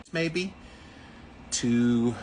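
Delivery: ordinary delivery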